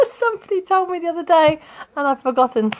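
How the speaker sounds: high-pitched laughing confession; giggling admission of forgetting. Real, not acted. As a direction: talk through real laughter, voice lifted high. Laughing at her own forgetting.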